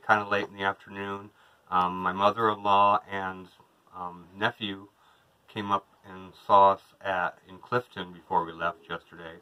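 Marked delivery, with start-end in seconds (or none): none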